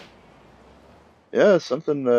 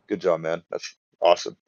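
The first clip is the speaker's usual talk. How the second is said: ordinary talk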